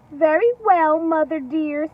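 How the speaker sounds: high, loud piglet chorus; little pigs voice. Acted. Three little pigs answering mother, high and bright. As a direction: chirp it like obedient piglets.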